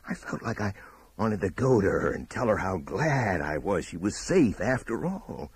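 ordinary delivery

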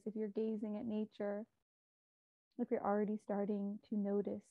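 calm, measured meditation guide with long pauses; unhurried, soothing meditation voice; guided meditation. Real, not acted. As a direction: guide listeners gently inward, leaving space between phrases.

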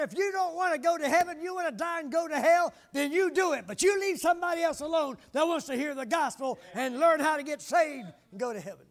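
loud, high-pitched rebuke; a preacher scolding the troublemakers; righteous indignation. Retold or imitated. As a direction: call out the back row with holy anger. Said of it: "a righteous indignation".

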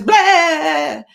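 sudden loud high "Blah!"; jump-scare the kids; mock scare. Teacher pretending to scare her class as the octopus, loud. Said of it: "Don't get scared".

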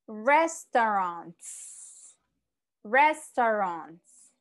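Restaurants said very slowly, syllable by syllable; slow model pronunciation. Shown as a demonstration. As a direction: stretch out Restaurants for the class. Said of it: "Como pueden ver y pueden escuchar". (pronuncia Restaurants muy despacio, sílaba por sílaba)

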